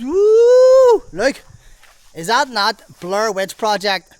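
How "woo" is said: long, loud, high ghostly wooo; spooky woo. Pretend. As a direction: wail a drawn-out mock ghost howl. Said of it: "it's got all them spooky vibes".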